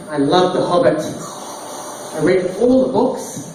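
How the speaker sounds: ordinary talk, nothing marked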